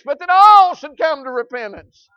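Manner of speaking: loud, high rising call; rising preaching climax. Real, not acted. Preacher calling all sinners to repentance, loud. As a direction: swell up into a shout.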